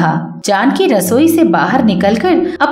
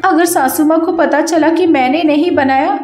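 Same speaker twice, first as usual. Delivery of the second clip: Kavita pleading; 6 semitones higher than usual. Acted. Kavita begs her sister-in-law's forgiveness with a higher whimpering plea. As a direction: fake a tearful apology.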